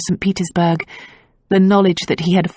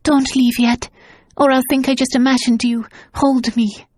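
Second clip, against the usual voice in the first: higher, urgent pleading; 7 semitones higher than usual. A narrator's reading. Tasia begging her husband to stay, higher and urgent. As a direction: hold on to him with your voice.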